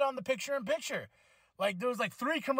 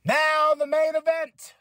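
announcer voice; 6 semitones higher, 6 dB louder, slower than usual. Pretend. Announcing the main event.

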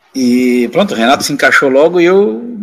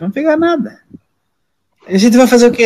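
high-pitched acted bravado; retold protest, sharply pitched up; defiant retort; 10 semitones higher, faster than usual. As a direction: brush off the threat of hazing. Rookie defiant before hazing.

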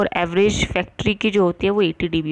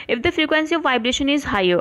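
dictation reading; 5 semitones higher, much slower than usual. Acted. Reads textbook line.

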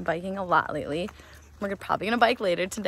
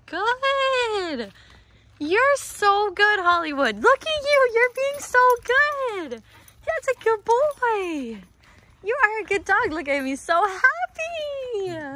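much higher, slow sing-song praise; 13 semitones higher, much slower than usual. Real, not acted. Owner praises her off-leash dog, high slow baby talk. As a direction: coo over him like a proud pet owner.

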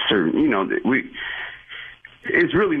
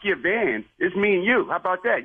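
higher-pitched indignant dare to meet up; heated challenge; 9 semitones higher than usual. Real, not acted. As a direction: rise up and call him out. Attendant challenges caller to meet.

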